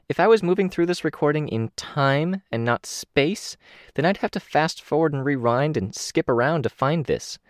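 put-on a chirpy young girl proving her point; Kenzie's eager voice. A narrator's reading. Young girl to her team, a high eager voice.

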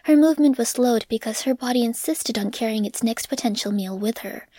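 ordinary delivery, nothing marked

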